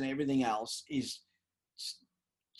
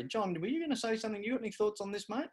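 higher-pitched, quicker coaxing question; acted prompt to colleague; 10 semitones higher, faster than usual. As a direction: lift the pitch and prompt him to speak. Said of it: "but I'd say".

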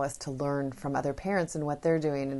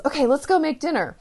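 bright, higher and louder upbeat call; cheerful invitation; 10 semitones higher, 5 dB louder than usual. Retold or imitated. Mom calling whining son to help cook, cheerful and bright. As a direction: mom sweeping her son into the fun. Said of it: "she got up, she goes".

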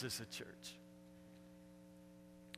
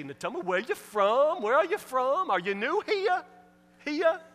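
Nan; 12 semitones higher, 9 dB louder than usual. Retold or imitated. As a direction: stretch "here" into two syllables, Southern style. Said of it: "You see, two syllables".

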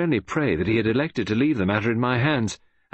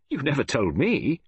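higher, mildly affronted complaint; indignant protest; 6 semitones higher than usual. Acted. Friend complains to detective he wasn't told, mildly indignant. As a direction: protest with pitch lifted. Hastings protests being used.

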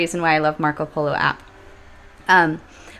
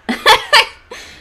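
sudden high loud laugh; burst of laughter; 9 dB louder than usual. Real, not acted. Laughing at herself about crying at dinner.

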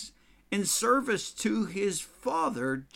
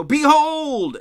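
behold shouted high and loud; exclamation; 11 semitones higher, 12 dB louder than usual. Retold or imitated. Someone calling a crowded room to attention, shouting. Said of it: "often this word is used with exclamation".

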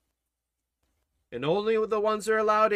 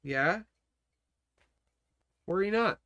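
calm Lord; 5 dB quieter than usual. Acted. Preacher voices the Lord answering.

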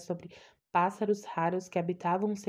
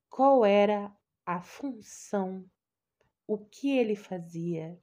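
ordinary delivery

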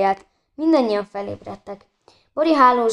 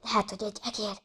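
hushed, much softer whisper; 11 dB quieter, faster than usual. A narrator's reading. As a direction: whisper it as if something is hiding nearby. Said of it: "suttogta Anna".

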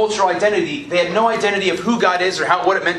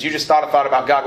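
normal talking voice

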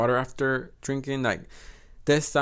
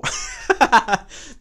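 breathy laugh; 11 semitones higher, 6 dB louder than usual. Real, not acted. Laugh mid sentence.

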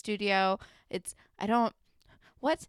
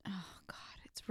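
whispered under the breath; exasperated whisper; 3 semitones lower, 16 dB quieter than usual. Real, not acted. Fed up with Paige while watching, whispered. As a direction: mutter it like you can't bear watching.

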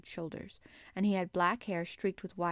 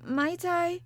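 put-on high, louder quavering child; nervous, pitched-up little girl; 11 semitones higher, 7 dB louder than usual. A narrator's reading. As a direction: quaver a timid request. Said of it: "quavered Mary".

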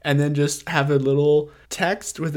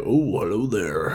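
deep James Earl Jones voice; 6 semitones lower than usual. Retold or imitated. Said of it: "a James Earl Jones voice".

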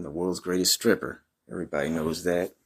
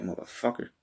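ordinary delivery

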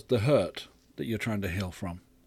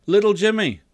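raised, carrying summons; calling out; 8 semitones higher, 6 dB louder than usual. A narrator's reading. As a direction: call to a child outside, high and loud. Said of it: "God calls".

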